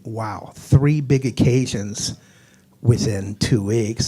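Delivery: slow, drawn-out words; slow emphasis. Real, not acted. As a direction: stretch out each word. Comic emphasis on three events.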